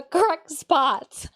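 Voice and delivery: high, loud, thrilled and laughing. Real, not acted. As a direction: squeal it with delight. Thrilled things line up.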